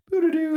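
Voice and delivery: very high, sing-song nonsense babble, mocking; mock celebrity babble. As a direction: parody snobs prattling on in a silly high voice. Mocking pretentious celebrity chatter.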